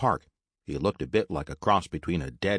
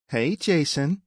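high-pitched greeting, lifting at the end; high female-character voice; bartender Jules; 10 semitones higher, 3 dB louder, slower than usual. Acted. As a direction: greet a regular in a raised, lilting voice.

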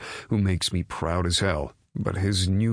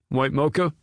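raised barista call; 9 semitones higher, 4 dB louder than usual. Acted. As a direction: call the order across the cafe. Barista calling out an order.

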